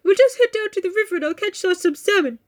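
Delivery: high put-on character voice; bear suitor. Bear planning dinner for Jo, put-on voice.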